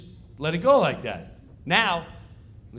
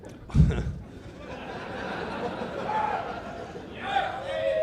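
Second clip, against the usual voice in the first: laughing; 4 dB quieter than usual. Real, not acted. Laughs at the memory.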